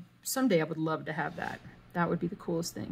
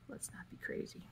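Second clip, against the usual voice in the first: quiet aside; 14 dB quieter than usual. Real, not acted. Self-talk aside.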